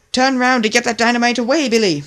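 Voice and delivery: loud, high yelled warning; warning the tug off with a loud yell. A narrator's reading. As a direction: shout the warning across the water. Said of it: "yelled Sunshine".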